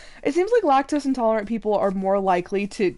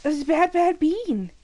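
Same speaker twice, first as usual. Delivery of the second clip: high playful scolding; 7 semitones higher, slower than usual. Real, not acted. Bickering playfully with the dog.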